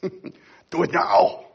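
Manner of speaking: put-on slow, heavy German action-hero accent; Arnold Schwarzenegger. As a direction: drag it out like a movie muscleman. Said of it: "That might have been my Arnold Schwarzenegger".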